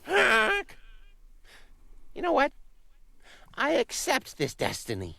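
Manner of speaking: high, excited goose voice; a dim, eager goose. Acted. Goose character.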